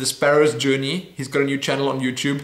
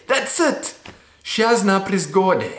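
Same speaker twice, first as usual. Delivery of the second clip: much higher, bright "That's it!"; triumphant; 5 semitones higher, slower than usual. Real, not acted. Vlogger cheering after nailing Polish pronunciation. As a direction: celebrate getting it right. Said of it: "got the word right".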